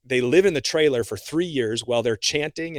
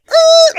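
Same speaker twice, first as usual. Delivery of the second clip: loud high startled yelp; 16 dB louder than usual. Retold or imitated. Driving past a naked man, a loud high yelp. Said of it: "I was like".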